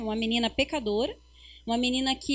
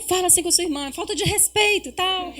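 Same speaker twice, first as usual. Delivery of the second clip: fast, higher mother scold; quick scolding; 6 semitones higher than usual. Retold or imitated. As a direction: rattle off a telling-off. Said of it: "Eu falo".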